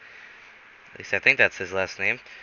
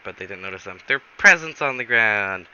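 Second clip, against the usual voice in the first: ordinary delivery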